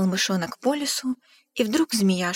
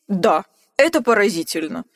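lightly voiced monster; 5 dB louder than usual. Gruffalo exclaiming in amazement at the mouse. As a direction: marvel aloud. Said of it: "воскликнул страшный зверь".

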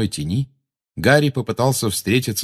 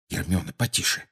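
hushed, low warning; muttering; 3 semitones lower, 7 dB quieter than usual. A narrator's reading. Harry warns Hermione to keep it down, muttering. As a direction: mutter a soft plea for quiet. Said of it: "пробормотал Гарри".

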